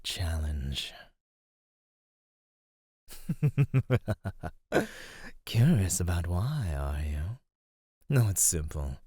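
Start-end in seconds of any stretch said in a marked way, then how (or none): 3.1-5.4 long, stuttering dark chuckle, rising in pitch; amused menacing chuckle. Acted. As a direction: let a knowing villain's chuckle roll out. Predator amused by captive's question.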